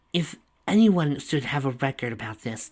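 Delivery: ordinary delivery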